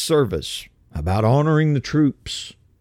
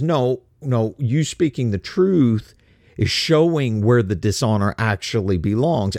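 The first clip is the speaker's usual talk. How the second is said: usual speaking voice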